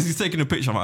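usual speaking voice

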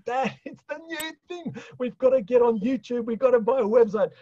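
hammed-up pleading with dad; mock son pleading. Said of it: "and his great accent".

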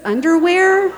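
underwear called out high and loud; listing underwear. Retold or imitated. The speaker lists her bag contents for the airport agent, high and loud. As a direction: hit the last item with a burst of comic volume.

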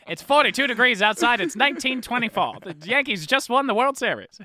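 put-on old-timey announcer voice. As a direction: call it like a 1924 ballpark broadcaster. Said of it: "the old-timey announcer voice".

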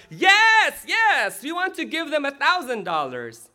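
high, loud, eager cheering that eases off; imitated kids' shout. Preacher voices kids answering the teacher, excited mock shout. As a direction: shout it like a roomful of keen children. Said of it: "Again, the kids shouted".

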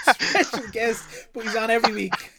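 loud, high laughing mockery; teasing laugh. Real, not acted. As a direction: tease him through laughter. Mocking special guest claim.

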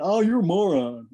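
a wife mimicking her husband's American twang on moron; mock American accent. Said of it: "goes on for an American".

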